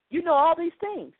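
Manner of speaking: ordinary delivery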